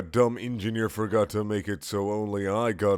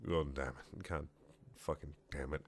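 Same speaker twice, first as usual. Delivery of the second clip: usual speaking voice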